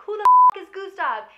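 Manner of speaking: very high, very loud outraged yell; furious shout. Retold or imitated. Heir yelling at the will reading, outraged. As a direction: explode at the room.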